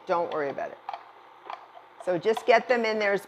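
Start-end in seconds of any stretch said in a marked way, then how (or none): 0.1-0.8 lower softer reassurance. Shown as a demonstration. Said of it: "I mean it".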